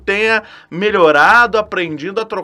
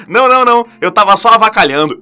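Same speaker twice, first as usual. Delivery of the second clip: loud, flustered brush-off; nervous laugh; 4 semitones higher, 7 dB louder, faster than usual. Retold or imitated. Boy dodges the girl's question, nervous loud brush-off. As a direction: laugh it off in a panic. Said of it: "eu dei uma risadinha e falei".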